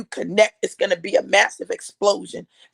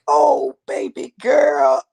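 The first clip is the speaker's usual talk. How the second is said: put-on choked-up "oh baby girl"; tender, tearful, slow; dad's tearful voice; 6 semitones higher, 7 dB louder, much slower than usual. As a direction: voice catching as dad says baby girl. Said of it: "and he was like".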